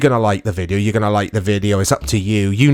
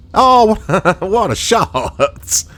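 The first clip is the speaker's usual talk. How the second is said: laughing delight; 8 semitones higher, much slower than usual. Real, not acted. Reacts to a physics throw.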